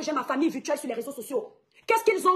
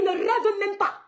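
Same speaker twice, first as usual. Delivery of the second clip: 1 scornful put-down; 4 semitones higher, much slower than usual. Real, not acted.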